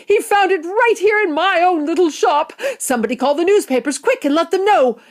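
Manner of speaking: loud, high shopkeeper in a frenzy; excited shouting. A narrator's reading. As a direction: call out the news urgently. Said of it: "he shouted".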